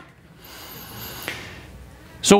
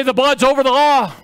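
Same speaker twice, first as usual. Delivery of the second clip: higher preaching call; leading the crowd; 5 semitones higher than usual. Shown as a demonstration. Preacher asking the congregation to repeat it, emphatic call.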